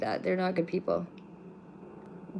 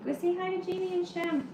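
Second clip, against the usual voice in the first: high, sing-song urging to a pet; high-pitched pet voice; coaxing pet-talk; 11 semitones higher, 5 dB quieter than usual. Real, not acted. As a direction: send the cat off to greet people, voice pitched way up. Urging the pet to greet viewers.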